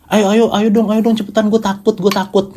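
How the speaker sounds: high-pitched mock panic, no real shouting; teriak panik tiruan. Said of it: "Langsung buru-buru teriak tuh kayak". (pura-pura panik ketakutan, nada tinggi)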